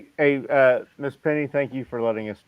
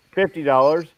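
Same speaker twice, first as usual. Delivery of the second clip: mock shock at the price, a bit louder; 4 semitones higher, 6 dB louder than usual. Guy at the table reacting to the steak price, shocked. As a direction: blurt out the price in disbelief.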